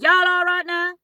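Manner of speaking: loud, high American stewardess voice. Retold or imitated. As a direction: mimic an American flight attendant calling out. Imitating American flight attendant on plane.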